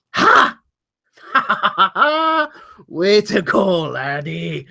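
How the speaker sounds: excited cheering. Acted. Maroon claps Bentley on the shoulder, excited and laughing. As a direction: burst out laughing, then drop in pitch on the praise. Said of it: "he's got this e-, ex-, excited face".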